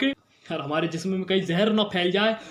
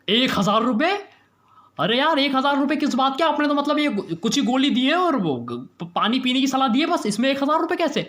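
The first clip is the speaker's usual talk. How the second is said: high, stammering protest; indignant shock; 5 semitones higher than usual. Acted. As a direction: object in disbelief to the fee. (हैरान होकर विरोध करते हुए)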